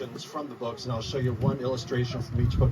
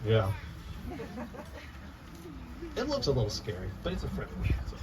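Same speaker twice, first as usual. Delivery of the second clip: usual speaking voice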